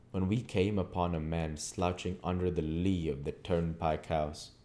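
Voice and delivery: ordinary delivery, nothing marked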